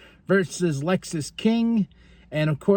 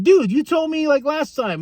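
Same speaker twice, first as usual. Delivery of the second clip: annoyed retort; 10 semitones higher, 4 dB louder than usual. Retold or imitated. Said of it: "Nathan's like".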